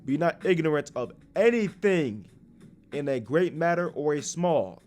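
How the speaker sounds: preacher reading Sirach aloud; scripture reading. Acted. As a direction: proclaim the verse with force.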